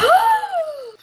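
high thrilled gasp; excited gasp. Real, not acted. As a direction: gasp with delight. Excited at leveling up.

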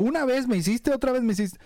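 put-on tally up every old offence, whiny; mock grudge-keeper. Mocking someone who keeps throwing old offences back, nagging. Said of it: "Vulgarmente dijéramos cuentachiles".

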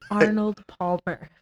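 put-on "Arnold Palmer" slow and slurred, ARN-old PAR-mo; mock mumbled. Shown as a demonstration. Imitating the mumbling food runner, slow. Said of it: "mine is better than yours".

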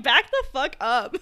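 slow, laughing mock threat; half-laughing back-off; laughing warning. Telling guy to back off.

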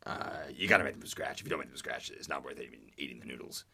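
lightly voiced impression, dropping low and quiet; Will Arnett. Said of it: "this guy as Will Arnett".

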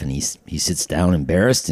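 usual speaking voice

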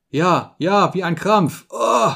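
pained groaning. A narrator's reading. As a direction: agree through the pain, rising to a groan. Sick man in cramp groans.

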